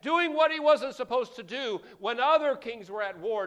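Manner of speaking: loud, high, rising sermon climax; fiery preacher hammering the sin home; full-force preaching. Acted. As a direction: preach it hard and build.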